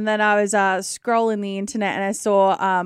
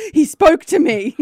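higher, louder, thrilled retelling; retold excited announcement; 6 semitones higher, 7 dB louder than usual. Host re-enacts telling her partner on a call, excitedly amazed. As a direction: burst in with astonishing news.

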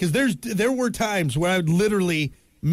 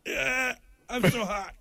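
stiff frozen face; 5 semitones higher, 6 dB quieter, slower than usual. Pretend. Frozen face after surgery.

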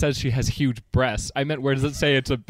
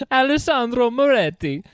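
Alessandro Moretti with Italian vowels and tapped r, higher pitch; Italian accent; 10 semitones higher, 3 dB louder than usual. Shown as a demonstration. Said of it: "in the correct Italian accent".

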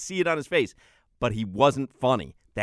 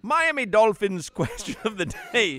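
a laughing go at an Irish lilt, pitched higher; hammed-up Irish accent; mock Irish accent; 8 semitones higher than usual. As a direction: try an Irish brogue and crack up. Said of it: "Is that Irish?".

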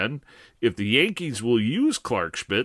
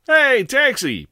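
much higher, louder, slow cab call; hailing a taxi; 13 semitones higher, 7 dB louder than usual. Acted. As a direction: flag down the cab, loud.

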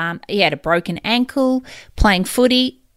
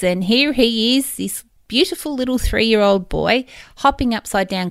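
ordinary talk, nothing marked

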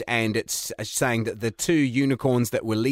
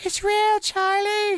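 much higher insistent cartoon unicorn; slower than usual. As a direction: insist on it in a high voice. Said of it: "a unicorn lecturing other unicorns".

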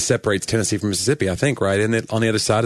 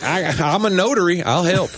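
higher, eager helper voice; chirpy offer to help; lightly voiced eager producer; mock producer; 8 semitones higher than usual. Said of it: "He was like".

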